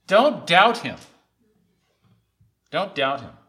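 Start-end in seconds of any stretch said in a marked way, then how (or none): 0.1-1.0 loud, raised command to the congregation; emphatic, high and loud warning; forceful exhortation. Real, not acted. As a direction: hit it hard from the pulpit. Preacher's forceful charge, then repeated.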